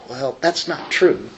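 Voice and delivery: normal talking voice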